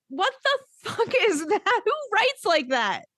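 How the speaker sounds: high-pitched, laughing disbelief; incredulous. Real, not acted. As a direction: squeal it in scandalised disbelief. Ridiculing the wording of the text.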